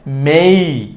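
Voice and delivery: long মেই gliding to i; correct pronunciation. Shown as a demonstration. Teacher giving the right form, drawn-out মেই. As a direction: stretch মেই from e into i. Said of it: "সঠিক উচ্চারণটা হবে".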